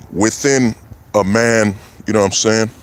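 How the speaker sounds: ordinary talk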